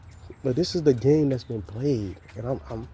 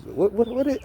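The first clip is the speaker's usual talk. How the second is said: mock flustered stammer; 11 semitones higher than usual. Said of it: "you all gotta lie and be like".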